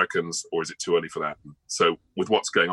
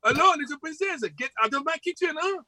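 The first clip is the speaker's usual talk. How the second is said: much higher, comic French chef accent; a fussy cartoon French chef shooing her out; over-the-top French accent, pitched way up. Shown as a demonstration. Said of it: "French chef who talks a bit like this".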